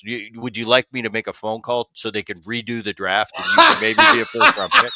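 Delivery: mock the whining son with a sneering offer; dad mocking his son's draft complaint, loud sarcasm. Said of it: "he's essentially just mocking me".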